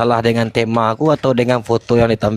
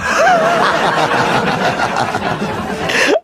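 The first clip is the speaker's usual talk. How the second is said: squeaky high laugh; high-pitched laugh. Real, not acted. High laugh after donut joke.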